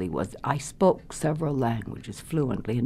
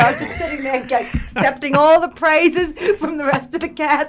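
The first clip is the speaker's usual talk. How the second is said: high, loud, amused confession; tickled storytelling with laughter breaking in; laughing; 10 semitones higher, 5 dB louder than usual. Real, not acted. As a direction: laugh through your own blunder, voice pitched up. Laughs at her own missed cue.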